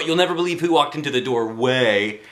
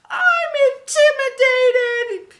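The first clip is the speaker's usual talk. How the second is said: very high, slow, frightened squeak; scared girl; 4 dB louder, slower than usual. Acted. Timid Emily admitting she's scared, very high and slow. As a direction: whimper it out, scared.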